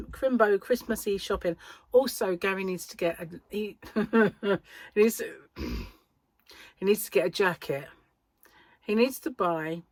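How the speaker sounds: normal talking voice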